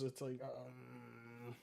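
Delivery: low, soft creaky drag; vocal fry. Shown as a demonstration. Streamer shows chat what vocal fry is, drawn-out vocal fry. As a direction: let it sink into a long creak. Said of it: "when somebody does this with their vocals".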